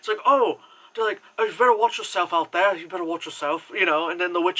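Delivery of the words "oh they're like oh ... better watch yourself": mock English woodsman, louder and higher at first; imitated English accent. Reviewer mocking the film cast's accents, exaggerated English accent. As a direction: put on an English accent for a warning. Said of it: "Everyone else is like".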